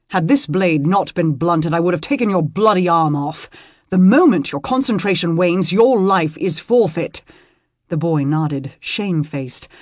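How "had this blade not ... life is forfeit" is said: loud, stern scolding; stern rebuke. Acted. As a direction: drive the lesson home hard. Lord rebuking his squire.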